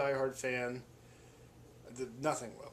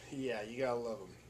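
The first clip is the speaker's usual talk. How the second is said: usual speaking voice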